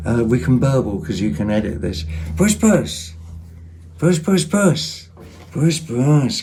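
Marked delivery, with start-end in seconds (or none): 2.4-6.4 high sing-song "puss, puss" call; calling the cat. Real, not acted. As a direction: coax the cat over, high and lilting.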